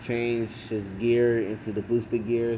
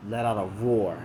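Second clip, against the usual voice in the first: ordinary delivery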